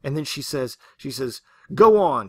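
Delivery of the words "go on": loud, high shouted dare; yell. Retold or imitated. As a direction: fling it out at full voice. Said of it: "she yelled".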